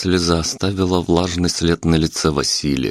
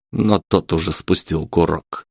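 usual speaking voice

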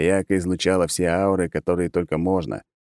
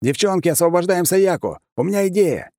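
yelling; 11 semitones higher than usual. Acted. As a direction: shout the order to the team. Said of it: "И снова заорал".

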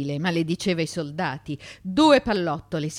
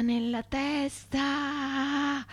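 higher, slow, drawn-out anguish; anguished cry; 8 semitones higher, 3 dB quieter, much slower than usual. Acted. Milton fearing a shot to the head, slow anguished cry. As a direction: stretch it out like a dying plea. Fugitive's desperate thought.

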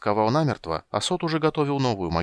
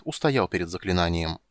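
ordinary delivery, nothing marked

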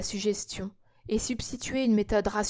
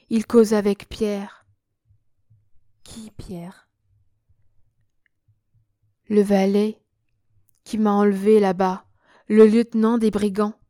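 loud trance report; 7 dB louder than usual. Acted. Entranced prince reports the vision.

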